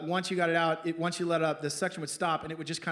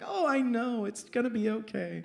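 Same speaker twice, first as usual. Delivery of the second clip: higher-pitched mock sympathy; cooing consolation; 6 semitones higher than usual. Friends consoling the crying wife, cooing. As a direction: coo it like an oblivious comforter.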